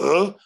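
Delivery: high, loud puzzled grunt; confused grunt. Retold or imitated. Possessed girl hears the ocean riddle, loud confused grunt. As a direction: blurt a baffled "huh". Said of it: "And she goes".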